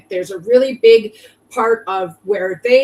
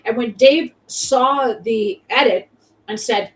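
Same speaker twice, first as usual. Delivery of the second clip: ordinary talk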